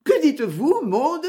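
high, alarmed question; terrified cry. A narrator's reading. Christabel questions her sobbing maid, horrified. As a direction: exclaim in acted horror. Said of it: "s'écria Christabel épouvantée".

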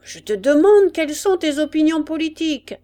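loud, high, impatient questioning; insistent demand. A narrator's reading. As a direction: bark the question as an impatient sergeant. Sergeant repeats his unanswered question. (exigence impatiente et forte)

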